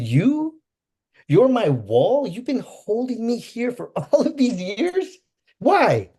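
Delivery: high, loud, slow accusing cry; angry confrontation. Retold or imitated. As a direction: confront him, stunned and furious. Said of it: "I was mad".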